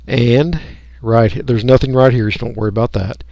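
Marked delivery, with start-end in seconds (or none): none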